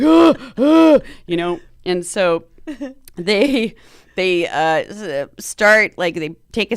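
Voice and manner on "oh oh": re-enacted high, loud "oh" yelps; two mock yelps of pain; pained cries. Patient during the injection, imitated pained cries. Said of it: "So then I'm like".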